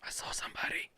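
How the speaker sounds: hushed whisper; sneaking whisper. Real, not acted. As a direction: whisper so nobody hears. Hiding from enemy.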